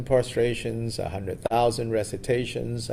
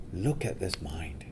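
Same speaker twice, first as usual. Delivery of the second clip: secret whisper; 5 semitones lower, 7 dB quieter than usual. Acted. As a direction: breathe it like a secret. Milarepa's instruction to boy.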